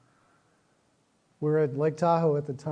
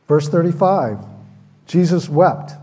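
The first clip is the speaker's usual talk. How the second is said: verse read out loud and strong; booming scripture read; proclaimed loud; 10 dB louder than usual. Real, not acted. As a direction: announce the verse with force. Reading the key verse aloud.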